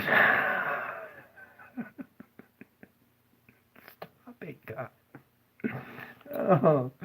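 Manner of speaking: real giggle breaking through the words; can't keep a straight face, whispering through laughs; laughing. Real, not acted. Talking to himself mid-video, caught in a real laughing fit. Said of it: "I'm laughing".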